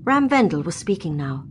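plain reading voice

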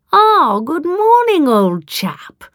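slow, high-swooping greeting; drawn-out, over-jovial old chap; affected hearty gentleman. A narrator's reading. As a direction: greet them with showy local banter.

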